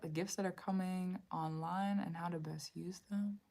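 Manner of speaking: breathy whispered question to the cards. Real, not acted. Reader whispering her question to the cards. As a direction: whisper it as if asking the cards privately.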